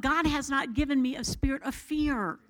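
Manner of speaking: normal talking voice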